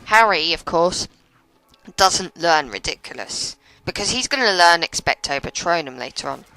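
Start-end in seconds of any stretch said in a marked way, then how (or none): none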